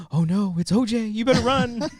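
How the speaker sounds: gasp, then a high hammed-up warning; udawana panika. Mock panic in retold film joke.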